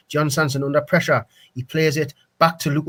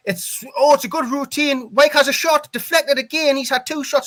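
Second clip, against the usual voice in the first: excited; 8 semitones higher than usual. Real, not acted. Goalmouth scramble, live commentary.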